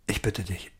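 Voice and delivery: quiet pleading. Acted. Dying man begs Emma to help him die, quiet pleading. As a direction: plead softly, with little force.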